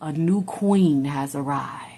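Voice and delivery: hushed gloating whisper; slow, breathless triumphant whisper; mock whisper. The home wrecker imagining taking over, a slow whisper. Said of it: "and be like".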